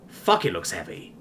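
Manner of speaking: ordinary delivery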